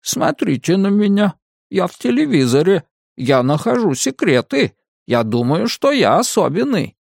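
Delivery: high, mocking puppet voice; glove puppet voice. A narrator's reading. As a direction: mimic the victim through a hand puppet, high-pitched. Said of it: "стал говорить от её лица". (высокий голос куклы, с издёвкой)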